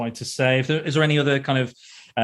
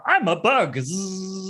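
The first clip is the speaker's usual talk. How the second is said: high-pitched silly bug voice, buzzing off at the end; high mock-crazy cry into a buzz; crazed bug impression; 6 semitones higher, much slower than usual. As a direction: mimic a madman who thinks he's a bug. Acting out the film's crazed gag.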